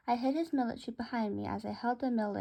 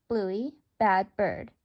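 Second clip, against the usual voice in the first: slow, low, louder scold; mock scolding; 4 semitones lower, 5 dB louder, much slower than usual. Narrator scolds Bluey for biting Mango, slow low mock scold. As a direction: tell off a naughty pet.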